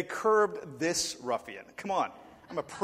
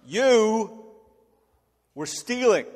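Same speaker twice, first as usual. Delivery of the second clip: louder, stern accusation; grown-ups accusing the boy of theft, louder; accusing adults; 5 semitones higher, 7 dB louder, much slower than usual. Retold or imitated. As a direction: pin the blame on the kid. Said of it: "And they're like".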